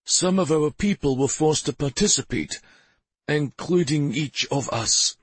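a hint of a confessing town mayor; mayor's voice. A narrator's reading.

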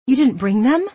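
high, loud and panicked. Acted. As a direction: ask in alarm, voice climbing. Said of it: "I asked in a raised, panicky-sounding voice".

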